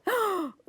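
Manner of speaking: high storybook gasp; dramatic gasp. Acted. Teacher reading crayon book aloud, dramatic gasp. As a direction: draw a big startled breath.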